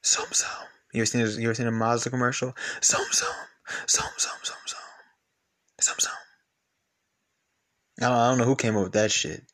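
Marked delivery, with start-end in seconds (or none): 2.8-6.1 whispered sum sum sum like the ad; mock jingle whisper. Mocking the Mazda ad, a whispered jingle. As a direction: hiss out the car jingle.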